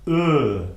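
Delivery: "E" as a held "eu"; loud, isolated mute "E"; French mute E. Shown as a demonstration. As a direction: sound "E" as a rounded uh. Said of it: "the mute E".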